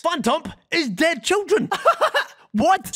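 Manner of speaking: high laughing disbelief; laughing, stunned. Real, not acted. Laughing at discovery.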